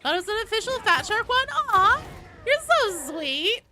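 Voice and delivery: high, loud, touched squeal; delighted high-pitched fawning; gushing delight. Real, not acted. Gushing thanks to a viewer's compliment.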